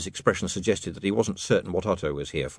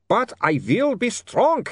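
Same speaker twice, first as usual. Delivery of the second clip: high, slow vampire cry; screamed resolve; 12 semitones higher, 4 dB louder, slower than usual. A narrator's reading. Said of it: "he screamed".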